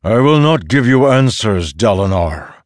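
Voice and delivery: loud, high, forceful storm-god voice; thundering refusal. A narrator's reading. Storm god refusing Dalinar answers, thundering. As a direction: refuse him with booming force.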